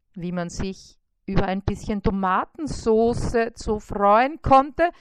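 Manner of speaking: usual speaking voice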